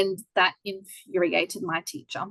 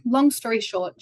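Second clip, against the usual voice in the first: ordinary talk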